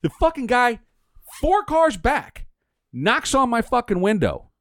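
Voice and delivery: high, slow, indignant retelling; worked-up retelling. Retold or imitated. Telling friends about the rude driver, slow and indignant.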